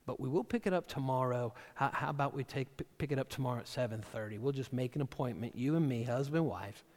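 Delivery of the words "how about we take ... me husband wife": ordinary talk, nothing marked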